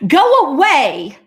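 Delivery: high, loud, slow "go away"; angry cat's drawn-out command; mad cat. Acted. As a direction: send it off with a cross shout. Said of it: "that cat is mad".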